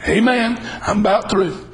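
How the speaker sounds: normal talking voice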